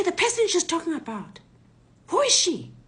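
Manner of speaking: high, loud, insistent questioning; agitated demand. Retold or imitated. Robbers question the daughter about the person, high agitated demand. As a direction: act out panicked robbers interrogating.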